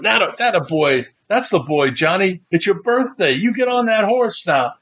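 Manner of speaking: mock holler, rising in pitch; big guy hollering. Big guy cheering Johnny onto the horse, hollering. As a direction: egg him on like a big hollering man. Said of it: "the big guy was hollering".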